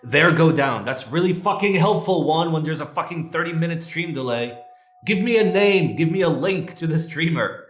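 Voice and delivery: loud, high frustrated yelling; angry shouting. Real, not acted. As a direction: blow up at the useless help. Yelling at delayed chat helper.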